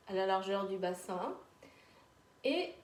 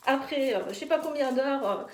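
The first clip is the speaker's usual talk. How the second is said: loud, fast, high pep talk; energetic coaching; 5 semitones higher, 5 dB louder, faster than usual. Real, not acted. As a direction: fire everyone up to let go. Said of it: "energetic coaching during shaking exercise".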